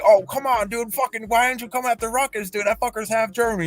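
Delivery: high-pitched mock pleading; retold protest. Arguing with Scum that Ruckus is German, high retold protest.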